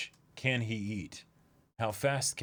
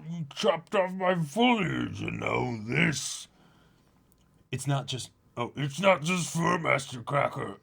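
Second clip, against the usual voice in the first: slow, higher put-on villain; cartoon villain voice; 4 semitones higher, 4 dB louder, slower than usual. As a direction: growl out a hammed-up villain. Dubbing Cracker character lines.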